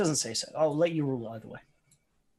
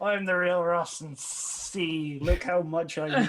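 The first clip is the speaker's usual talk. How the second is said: slow, drawn-out, raised mock villain voice; mocking impostor; 4 semitones higher, much slower than usual. Player posing as the real enemy leader, mocking. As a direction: drag out the words to impersonate a rival leader.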